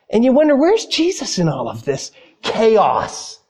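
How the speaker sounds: normal talking voice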